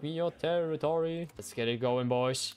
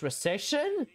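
alarmed; 10 semitones higher than usual. Real, not acted. Dreading recession in game.

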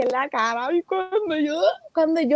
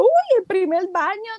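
louder, higher thrilled squeal; filming the pet's first bath, excited and louder; 6 semitones higher, 4 dB louder than usual. Retold or imitated. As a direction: gush over the first bath. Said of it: "Y yo bien emocionada con la cámara".

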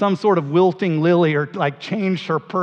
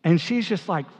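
ordinary talk

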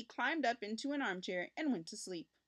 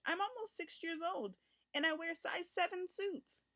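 put-on higher, slower earnest child voice; urging boy; 8 semitones higher than usual. A narrator's reading. Richard urges mother he is old enough, slow earnest plea. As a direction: press the case like a proud little boy. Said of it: "he urged".

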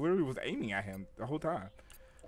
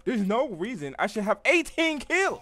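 boastful outburst; 9 semitones higher, 6 dB louder, slower than usual. Real, not acted. Gamer boasts about his kills.

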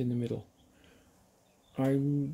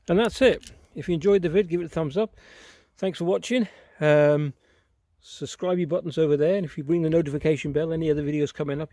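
loud, upbeat sign-off; bright outro to viewers; youtuber outro; 2 semitones higher, 6 dB louder than usual. Acted.

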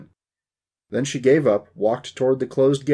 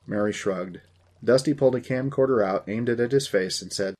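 ordinary delivery

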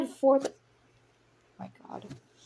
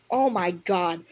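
usual speaking voice

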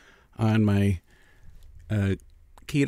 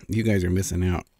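normal talking voice

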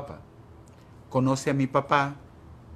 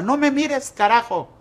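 high, loud furious yell; enraged mother screaming at her son; angry mother yelling; 13 semitones higher, 8 dB louder than usual. Retold or imitated. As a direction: scream it down at a child. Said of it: "Y yo nomás la miraba".

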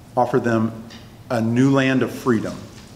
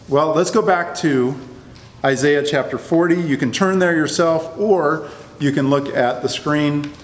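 ordinary talk, nothing marked

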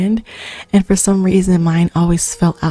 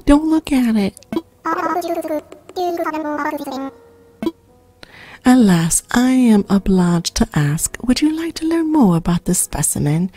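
higher, hammed-up squeal of disgust at a bug; mock-squeamish Blathers; 7 semitones higher than usual. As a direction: recoil from the pill bug like a fussy owl.

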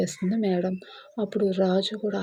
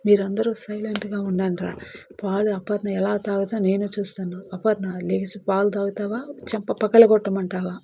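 louder stern grandmother; angry threat; 4 dB louder than usual. Acted. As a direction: threaten a slap. Grandmother threatens, forcing milk. (అత్తగారు కోపంగా బెదిరిస్తూ)

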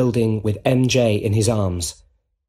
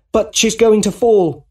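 high, louder cry of alarm; sudden rise in pitch and volume, alarmed; 11 semitones higher, 6 dB louder than usual. Acted. Peter fears MJ will fall, alarmed.

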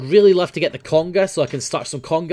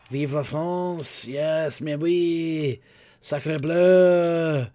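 put-on French accent; 3 semitones higher, much slower than usual. French hat unboxing.

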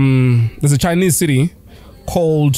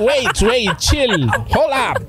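higher-pitched mock plea to hold on; stalling the thieves; 5 semitones higher, much slower than usual. Victim telling thieves to wait, mock stalling. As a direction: play stalling a burglar, half laughing. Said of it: "What do you tell the thieves?".

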